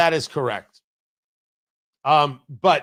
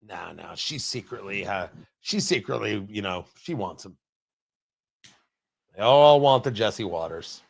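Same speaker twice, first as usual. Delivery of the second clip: much softer, lower, secretive; conspiratorial hush; 11 semitones lower, 5 dB quieter than usual. Pretend. Host confides the gossip to listeners, hushed. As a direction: lean in and confide.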